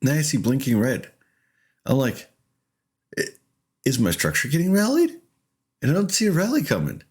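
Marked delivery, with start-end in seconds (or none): 3.2-5.2 confused question, pitched high and rising; high-pitched mock bafflement. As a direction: replay your baffled reaction, climbing high at the end. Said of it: "I'm still confused".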